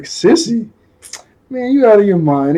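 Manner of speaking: mock-offended, pitch jumping up; incredulous denial. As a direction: act out a friend balking at the very idea. Acted scoffing denial of a crush.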